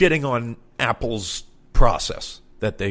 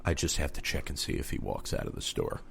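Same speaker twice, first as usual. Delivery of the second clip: hushed, lower and secretive; conspiratorial whisper; 8 semitones lower, 11 dB quieter than usual. Retold or imitated. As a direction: whisper it like a stakeout. Clerk's secretive explanation.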